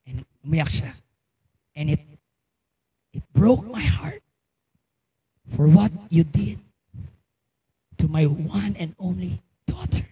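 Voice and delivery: normal talking voice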